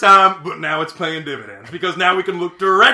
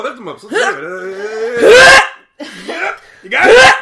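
high, loud strained voice; straining effort; 13 semitones higher, 11 dB louder, slower than usual. Acted. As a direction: strain hard as you lift. Straining to lift partner.

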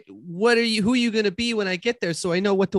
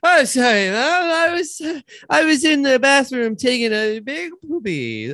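put-on flustered boy voice, pitched up; panicked child; 7 semitones higher than usual. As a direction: plead like a caught little boy. Child makes panicked excuse to mom.

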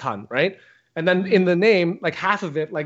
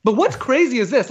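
ordinary talk, nothing marked

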